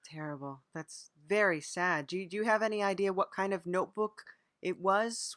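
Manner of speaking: ordinary talk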